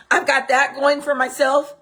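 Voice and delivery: usual speaking voice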